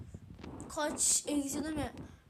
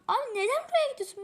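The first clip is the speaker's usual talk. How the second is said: whiny protest; 9 semitones higher, 5 dB louder, much faster than usual. Real, not acted. Whiny complaint at teammate.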